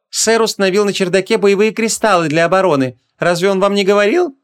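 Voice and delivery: high, stiff, formal voice; prim servant. Acted. Servant explaining the attic defences to mistress, prim.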